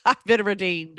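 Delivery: laughing through the words; chuckling repeat. Real, not acted. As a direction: giggle as you say it, amused at yourself. Laughs at own declaration.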